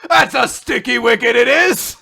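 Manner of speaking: high, loud hammed-up British accent; mock posh British shout. Imagining riders calling dragons, hammed-up British accent shouting. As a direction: yell it like a silly posh Brit. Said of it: "to command your dragon".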